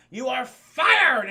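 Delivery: slow, very loud, high proclamation; booming dismissal. Acted. Kingpin fires disloyal Hobgoblin, booming dismissal. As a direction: draw it out and blast the last word.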